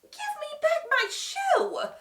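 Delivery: high, loud, slow indignant demand; Dorothy crossly demanding her shoe. A narrator's reading. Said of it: "said Dorothy crossly".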